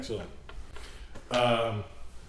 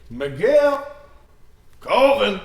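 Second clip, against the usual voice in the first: loud call across the square; 8 semitones higher, 8 dB louder, much slower than usual. Acted. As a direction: shout the names to find them. Calling out for lost friends.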